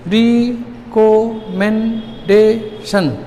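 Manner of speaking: "Recommendation" very slowly in five beats with gaps; counted syllables. Shown as a demonstration. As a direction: hold each syllable of "recommendation" for finger counting. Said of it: "उंगली पर गिनो".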